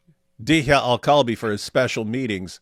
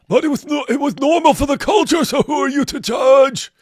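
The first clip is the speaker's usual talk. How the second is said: mock apologist; 13 semitones higher, 9 dB louder than usual. Mocking an apologist's excuse.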